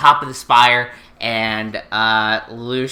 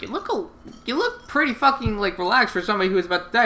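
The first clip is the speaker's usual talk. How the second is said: higher mock Suzaku; 7 semitones higher, faster than usual. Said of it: "Suzaku's like".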